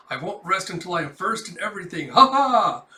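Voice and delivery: grand vow rising into a loud cackle; megalomaniac boast. Pretend. Yor imagining Melinda as First Lady, hammed-up megalomania. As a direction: declare ambition, then burst into loud mock-villain laughter.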